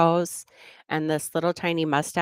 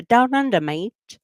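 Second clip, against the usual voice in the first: loud high Australian burst on "Down under", then a dropped "mate"; Australian crocodile hunter, shouted out; hammed-up Australian wildlife host; Steve Irwin; 6 semitones higher, 5 dB louder, slower than usual. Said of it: "I channeled my, uh, Steve Irwin".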